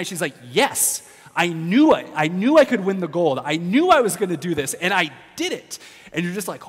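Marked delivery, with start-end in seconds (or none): 0.5-5.8 triumphant excitement. Retold or imitated. Wrestler telling the interviewer she knew she'd win, loud and jubilant. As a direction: shout it like you just won gold.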